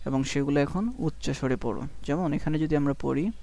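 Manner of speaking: usual speaking voice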